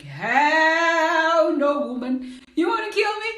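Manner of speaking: high, loud sing-song protest; mock outraged refusal. To the kind lady about her seatbelt, a high loud outraged refusal. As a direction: bellow it like she's asked the impossible.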